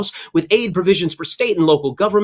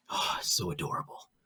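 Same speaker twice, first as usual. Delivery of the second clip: whispered coo, much softer and lower; 12 semitones lower, 16 dB quieter than usual. Acted. Host whispering to viewers over a cute baby picture, hushed coo.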